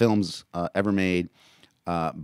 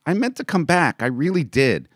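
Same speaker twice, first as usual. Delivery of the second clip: put-on high, louder boy's plea; higher, louder childish pleading; pleading little boy; 10 semitones higher, 8 dB louder than usual.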